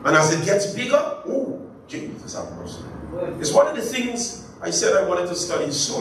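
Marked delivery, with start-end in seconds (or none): 1.0-2.8 breathy plea. Real, not acted. Preacher's breathy aside to God.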